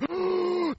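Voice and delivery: high, noisy drawn breath; horse-like gasp. Retold or imitated. Imitating someone prophesying in a meeting, horse-like gasp. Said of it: "breathed like a horse".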